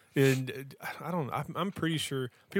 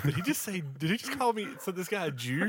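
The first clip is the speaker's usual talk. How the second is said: surprised commentator; 6 semitones higher than usual. Retold or imitated. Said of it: "Kevin Kelly coming on commentary saying".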